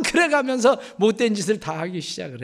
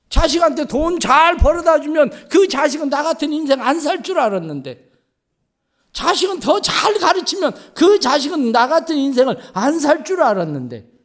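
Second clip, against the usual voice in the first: impassioned preaching; 8 semitones higher, 7 dB louder than usual. Acted. Preaching at full force.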